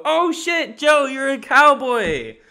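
hammed-up shock at a cowboy partner; mock surprised shout. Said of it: "And I'll go".

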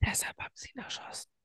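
hushed whisper. Real, not acted. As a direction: whisper so no one wakes up.

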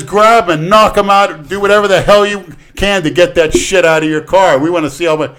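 shouting cop. Retold or imitated. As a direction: goad him like a rowdy cop. Cop egging him on.

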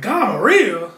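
loud shocked echo of the word; hammed-up disbelief. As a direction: blurt it back in disbelief.

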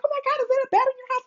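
very high, very fast imitated friend; high rushed imitation of the friend; excited friend. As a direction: rattle it off like the shocked friend. Friend on phone reacts.

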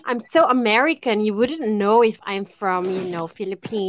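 slowing, sinking American drawl; exaggerated American accent. Shown as a demonstration. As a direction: show off a put-on American accent. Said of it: "yang American accent kay".

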